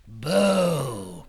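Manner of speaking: loud, drawn-out boo; big spooky boo; scare shout. Acted. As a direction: stretch it out to spook someone. Teenager tries a scare.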